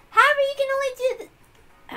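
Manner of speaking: put-on high, bright-pitched, loud know-it-all girl; Erica's voice. Retake in Erica voice.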